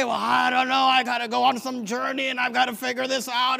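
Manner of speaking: high-pitched imitation of an overwhelmed objector; mock helplessness. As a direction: make a show of being hopelessly confused. Mocks someone overcomplicating it.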